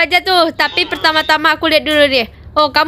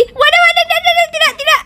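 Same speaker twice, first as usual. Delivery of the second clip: panicked shout; 10 semitones higher than usual. Real, not acted. Falling from the tower again.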